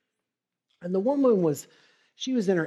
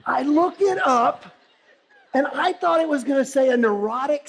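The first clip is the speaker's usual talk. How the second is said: higher and louder, laughing through it; laughing excitedly; 10 semitones higher, 8 dB louder than usual. Real, not acted. Speaker laughs telling own search.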